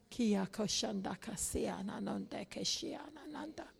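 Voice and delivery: unvoiced whisper in tongues. Real, not acted. Pastor whispering in tongues.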